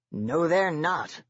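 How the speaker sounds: slow, forceful flat denial; very loud. A narrator's reading. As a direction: lay the words down hard and slow. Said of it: "Sergeant says very loudly".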